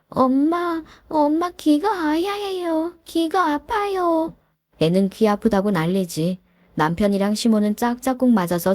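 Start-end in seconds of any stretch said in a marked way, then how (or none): 0.1-4.3 훨씬 높고 느린 칭얼대는 꼬마 목소리; 귀가 아파 엄마에게 칭얼대는 어린 딸처럼; 어린아이 목소리. Acted. 아픈 어린 딸이 엄마를 부름. (아파서 엄마를 찾는 어린 딸, 높고 느린 칭얼거림)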